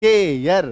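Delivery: केयर said with a brief touch in the mouth. Shown as a demonstration. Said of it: "मुंह एक बार ऐसे touch होगा".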